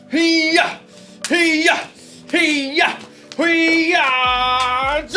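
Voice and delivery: high, loud karate yells; hero battle cry. Pretend. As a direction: shout like an action hero. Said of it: "am a hero".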